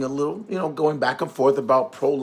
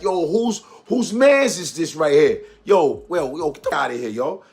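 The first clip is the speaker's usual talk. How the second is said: mock Bronx tough talk, louder; New York street voice; 3 semitones higher, 3 dB louder than usual. Streamer imitating a New Yorker squaring up in New York accent. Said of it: "New Yorkers be like".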